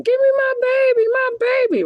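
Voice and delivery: re-enacted high, tearful shriek; hysterical crying. Mother pleading as husband takes her baby, hysterical crying. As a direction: cry it out in a very high, hysterical wail. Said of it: "I'm crying, like".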